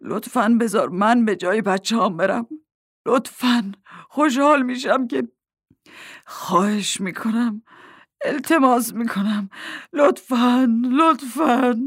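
tearful begging. A narrator's reading. Mack at the woman's feet for his children, begging in tears.

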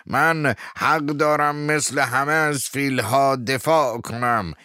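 high, loud, slow insistent shout; shouting again. Acted. Abe Fields insisting on defending the elephants, shouting. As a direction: hammer the point home at top voice. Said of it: "ایب فیلدز فریاد زد".

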